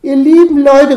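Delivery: loud, high call to the crowd. A narrator's reading. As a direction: cry out to everyone around.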